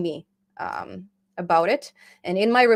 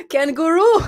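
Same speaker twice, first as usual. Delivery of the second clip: put-on higher, louder, a playful Australian accent; mock Australian accent; 9 semitones higher, 7 dB louder than usual. Answering a request to say something Australian. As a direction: throw out a word in a hammed-up Aussie accent. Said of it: "[name], say something in Australia".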